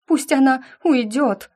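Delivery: high, upset demand; mildly tearful outburst. A narrator's reading. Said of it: "Всхлипывая".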